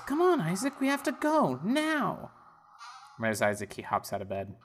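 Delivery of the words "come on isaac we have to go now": much higher mum voice; high and hurried, hustling the boy; urging mother. Acted. Mother hurrying Isaac out, high voice. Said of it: "And she goes".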